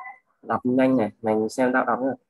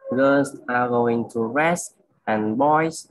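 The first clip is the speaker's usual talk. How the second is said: very slow, pausing phrase; slow read; much slower than usual. Shown as a demonstration. Tutor showing friend a slow, well-paused reading. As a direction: read each word slowly with breaks. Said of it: "đọc đây là đọc chậm nha".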